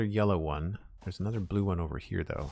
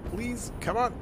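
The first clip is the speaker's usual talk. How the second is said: squeaky plea for jetpack fuel; high pleading; slower than usual. Real, not acted. Begging the jetpack to hold.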